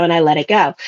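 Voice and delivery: ordinary delivery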